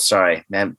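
usual speaking voice

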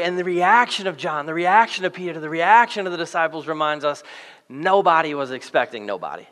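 usual speaking voice

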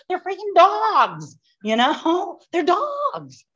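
high, slow, exasperated disbelief; a high, drawn-out cry of exasperation. Retold or imitated. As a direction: squeal it out in disbelief. Her own exasperated retort.

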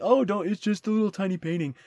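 lightly voiced grumbler, pitched a bit up; mock dismissive naysayer. Tourist waving off the Mona Lisa, mock dismissive. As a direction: put on a hint of a scoffing tourist. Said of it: "a lot of riffraff saying".

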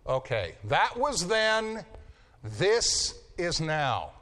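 slow, deliberate weight on this is now; emphatic contrast. Shown as a demonstration. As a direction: let "this is now" land slowly, word by word. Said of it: "I can't emphasize how much".

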